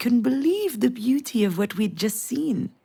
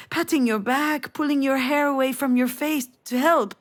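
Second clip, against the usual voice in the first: usual speaking voice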